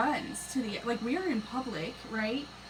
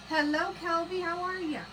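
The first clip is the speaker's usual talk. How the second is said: higher, cheery hello to a viewer; cheerful hello to a commenter; bright greeting; 8 semitones higher, 5 dB louder than usual. Real, not acted. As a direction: sing out the welcome.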